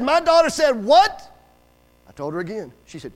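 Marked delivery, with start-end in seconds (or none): 0.0-1.3 slow, loud, high question rising at the end; incredulous mother. Retold or imitated. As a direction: stretch it out in outraged disbelief.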